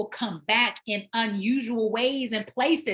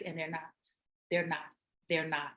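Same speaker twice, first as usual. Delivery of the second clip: softer, lower repeated they're not; quiet insistence; 5 semitones lower, 8 dB quieter than usual. Real, not acted. Trainer warning that surgery is no quick fix, quietly insistent. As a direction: let it sink in, low and soft.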